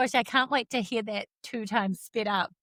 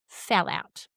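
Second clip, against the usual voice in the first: ordinary talk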